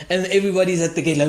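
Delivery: normal talking voice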